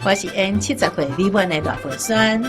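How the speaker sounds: ordinary delivery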